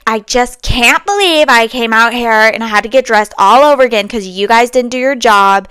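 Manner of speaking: loud indignant complaint, imitating the lady; angry customer. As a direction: hammer out the grievance like an offended client. Angry customer quoted in story.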